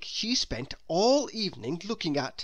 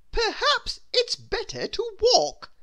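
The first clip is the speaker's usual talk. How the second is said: haughty grand lady; 6 dB louder than usual. Acted. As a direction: savour every word like a vain lady. Miss Splendid thinking.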